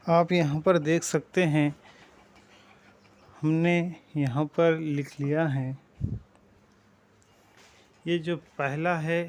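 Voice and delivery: ordinary talk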